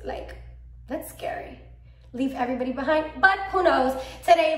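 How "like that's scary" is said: whispered aside. Real, not acted. Vlogger confides fear of island move, whispered. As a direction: whisper it, unvoiced.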